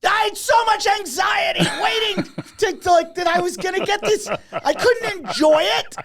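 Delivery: imitating a friend's frantic rant; mock outraged shout. Jerry ranting about the massage.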